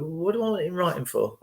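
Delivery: a hint of the friend, fast and puzzled; quick lightly voiced friend. Friend questioning the advice, fast and lightly voiced. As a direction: rattle it off like a baffled friend. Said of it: "She went".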